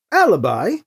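"Alibi" rising at the end; name as question. A narrator's reading. His aunt addresses her nephew, "Alibi" lifted into a question. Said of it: "his name a question".

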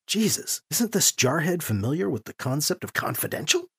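soft, exasperated muttered aside; quiet inner thought. A narrator's reading. Priest silently cursing the indiscreet commander, muttered.

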